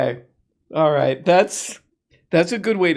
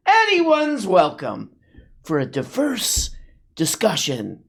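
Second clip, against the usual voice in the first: slow, much higher mock-sweet sing-song; sarcastic; 5 semitones higher, slower than usual. Mocking the podcast's claim of openness, sarcastic slow sing-song. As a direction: stretch it out with fake generosity.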